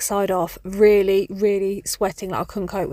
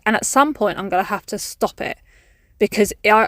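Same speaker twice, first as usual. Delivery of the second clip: ordinary talk, nothing marked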